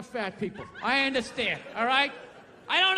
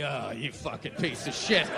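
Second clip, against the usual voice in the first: much lower, gruff self-loathing voice; self-disgust; 12 semitones lower, 4 dB quieter than usual. Retold or imitated. Hungover man to his mirror, low growl. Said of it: "you're like".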